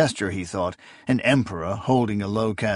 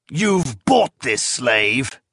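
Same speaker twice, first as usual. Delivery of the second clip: slow, accusing half-shriek; a touch of a shrieked charge; mild shriek; 2 semitones higher, much slower than usual. A narrator's reading. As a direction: spit the accusation out slowly. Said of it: "he shrieked".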